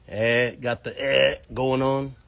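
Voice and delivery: slightly nasal, drawn-out "eh"; a hint of congested nasal whine; nasally. Shown as a demonstration. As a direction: show off a stuffed-up nasal honk, slowly. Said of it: "I'm really nasally, a little bit like Ned Flanders".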